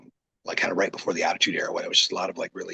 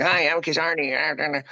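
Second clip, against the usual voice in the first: high, slow squeaky cartoon voice; 6 semitones higher, slower than usual. Shown as a demonstration. Wrestler demoing the silly high voice he was told to use, slow and high. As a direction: pitch it up like a chipmunk. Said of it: "I sound like Alvin and the Chipmunks".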